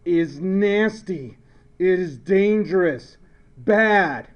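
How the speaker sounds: loud, emphatic condemnation; forceful, punchy disapproval; stern warning. Shown as a demonstration. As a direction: slam each verdict down separately. Contrasts nasty chlorine with happy chloride.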